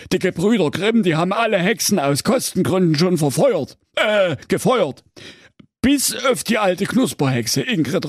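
high, nervous, fawning executioner; timid executioner voice. A narrator's reading. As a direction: grovel politely to the king. Timid executioner reports to king.

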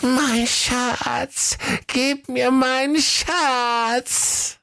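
very high, slow creature voice, longing at the end; weary Gollum. A narrator's reading. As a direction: drag it out like Gollum. (hohe, müde Gollum-Stimme)

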